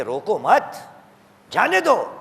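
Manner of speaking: low "روکو مت" rising at the end, pause, then "جانے دو"; pause after مت. Shown as a demonstration. Preacher repeats what ears were taught, pausing after مت. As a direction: keep مت with روکو, break before جانے دو. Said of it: "ہمیں ہماری کانوں میں یہی سکھایا گیا ہے".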